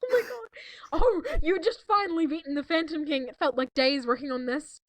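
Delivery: ordinary talk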